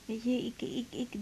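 soft breathy whisper, hesitant; whispered confession. Acted. Woman confessing to her lover at night, hesitant whisper. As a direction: let it out barely voiced, unsure.

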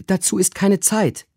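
high urgent cry of a maid; high impatient maid; Susanna calling out. A narrator's reading. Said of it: "rief Susanna".

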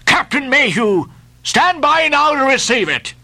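high-pitched captain's call to the boat; yelling. A narrator's reading. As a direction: hail the other captain and give the order. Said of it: "yelled Ahab".

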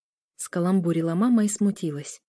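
usual speaking voice

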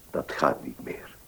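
a breathless whisper, barely voiced. Tired old notary turning down the lady, in a whisper. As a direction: let the words fade to a whisper.

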